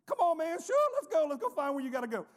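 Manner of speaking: high, loud, cheery "sure, let's go"; a tough guy suddenly bursting to help; eager helpful stranger. Retold or imitated. Imitating a scary stranger happily helping a lost man, high and loud.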